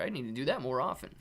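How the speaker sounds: normal talking voice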